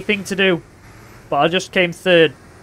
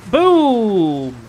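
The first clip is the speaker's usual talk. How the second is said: higher, drawn-out falling boom; booming hit call; 4 semitones higher, 4 dB louder than usual. Real, not acted. Gamer landing a hit, long booming call. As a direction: launch it high and let it slide down.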